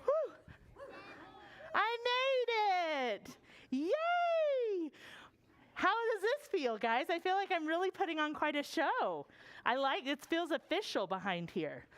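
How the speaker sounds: muffled from behind the puppet theater; excited voice from behind the booth; hidden in puppet booth. Real, not acted. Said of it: "I'm gonna go behind the puppet theater".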